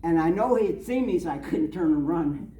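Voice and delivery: ordinary talk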